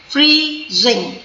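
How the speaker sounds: "फ्रीजिंग" in two separate beats, free, zing; split into syllables. Shown as a demonstration. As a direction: break "फ्रीजिंग" with a pause before zing. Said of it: "syllable है या टू". ("फ्रीजिंग" को फ्री - जिंग में तोड़कर)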